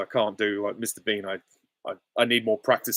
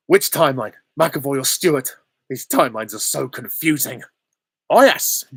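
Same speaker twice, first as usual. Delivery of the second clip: higher, louder comic antihero voice; David Tennant as Deadpool; 4 semitones higher, 7 dB louder than usual. Acted. Streamer performing a requested Deadpool quote, in character. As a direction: play it loud, leaping up at the end. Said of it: "David Tennant as Deadpool".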